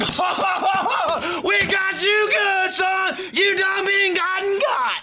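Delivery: shrill hooting taunt. Retold or imitated. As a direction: cackle and gloat like a backwoods prankster. Mocking cast laughing at Jeff.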